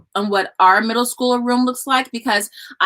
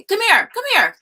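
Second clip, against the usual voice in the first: high, real call across the house; 10 semitones higher than usual. Real, not acted. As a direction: call your son into the room. Mom calling her son over.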